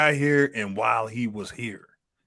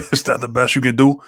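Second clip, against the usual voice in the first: lightly imitated Andor accent, a touch louder; Andor's accent; 6 dB louder than usual. Friend quoting Andor's challenge in his accent, half-hearted. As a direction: quote the line in his accent, a bit louder. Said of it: "And he said it with the accent too".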